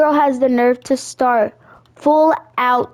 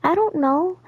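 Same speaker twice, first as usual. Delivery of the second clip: meek friend; 6 semitones higher than usual. Retold or imitated. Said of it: "And she was like".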